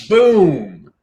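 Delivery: loud, high, punchy boom; punchline boom. Shown as a demonstration. Comedy coach marking the punchline hit, loud burst. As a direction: slam it down like a hammer. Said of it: "Bone crushing".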